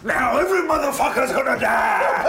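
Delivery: loud, high laughing villain threat; hammed-up evil villain shout; menacing monster voice. Playing monster in makeup.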